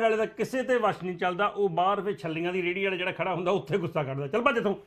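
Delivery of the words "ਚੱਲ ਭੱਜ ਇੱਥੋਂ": quick, gruff brush-off, lightly acted; mock gruff shooing. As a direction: shoo the street vendor away, half-playing. Homeguard shooing vendor, laugh follows. (ਮਜ਼ਾਕੀਆ ਝਿੜਕ, ਤੇਜ਼ ਤੇਜ਼)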